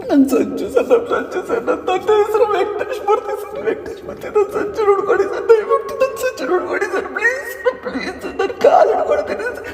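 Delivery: desperate sobbing plea, breaking into cries. Acted. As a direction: beg through tears for her lost son. Crying mother at station. (ಬಿಕ್ಕಿ ಬಿಕ್ಕಿ ಅಳುತ್ತಾ ಬೇಡಿಕೆ)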